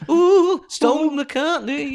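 high, slow teasing drawl of the name; sing-song taunt of Stone McCartney; drawn-out mock name. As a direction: stretch the name out, mocking.

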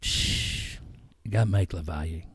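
hushed, low imitation of Marcus; lightly voiced friend on the phone; imitating Marcus. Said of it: "Marcus would call me".

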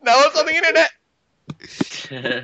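high, slow gloating shout; gleeful taunt. Real, not acted. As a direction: crow it out, triumphant.

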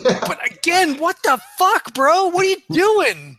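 high, exasperated what the fuck bro; incredulous yelling. Acted. Player as Nash confronting Reggie, high exasperated shout. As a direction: call out the friend, baffled.

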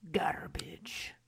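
soft, unvoiced whisper; hushed comic echo. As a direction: whisper it as a mock-dramatic aside. Echoing the word as a joke.

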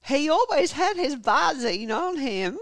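high, loud, slow Southern drawl; American Southern accent. Acted. Wife adding a detail for police, American Southern accent. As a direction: draw it out loudly in a Southern accent. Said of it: "tired of doing the accent".

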